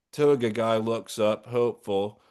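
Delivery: usual speaking voice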